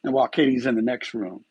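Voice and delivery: usual speaking voice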